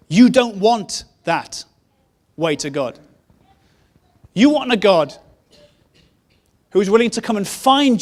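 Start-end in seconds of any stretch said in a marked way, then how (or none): none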